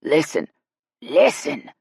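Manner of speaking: loud, rasped command. A narrator's reading. Guide hushing the laughing group, rasping. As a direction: rasp each word with a pause between. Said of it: "Royce rasped".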